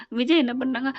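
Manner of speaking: ordinary delivery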